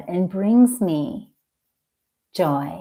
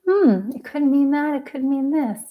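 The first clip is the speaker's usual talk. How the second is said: higher, musing hmm, weighing options; wiser self pondering; 7 semitones higher than usual. Acted. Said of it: "with that self and I can say".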